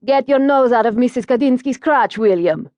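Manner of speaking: mock-serious Italian-accented scolding; Italian stepfather playfully telling off the dog; thick Italian accent with put-on sternness; Dino Cavalli's Italian accent. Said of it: "I can hear his voice even now in his Italian accent".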